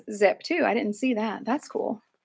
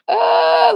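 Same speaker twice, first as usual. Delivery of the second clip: high, drawn-out mock "uh"; alarmed groan; 12 semitones higher, 4 dB louder than usual. Shopper stuck next to the fight, high acted groan. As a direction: replay a high, uneasy groan.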